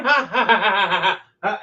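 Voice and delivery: a staged ha-ha in the dark; hammed-up laughing. Dragonborn laughing behind the curtain, mock laughter. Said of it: "seeing her in shadow silhouette going".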